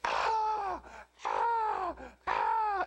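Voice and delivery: high squawking parrot screams; parrot screaming. Retold or imitated. Parrot screaming for attention, very high squawk. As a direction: shriek like an ignored parrot. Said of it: "he'd just go".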